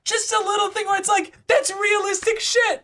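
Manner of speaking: very high, loud, emphatic; shouting. Real, not acted. Rant peak, shout heard.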